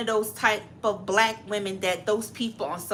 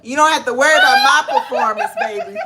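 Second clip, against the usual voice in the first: laughing mock brag; 5 semitones higher, 10 dB louder, faster than usual.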